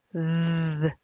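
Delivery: sustained buzzing TH; th drawn out, tongue between the teeth; voiced fricative TH. Shown as a demonstration. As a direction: hold th with voiced friction of airflow. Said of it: "is a voiced fricative".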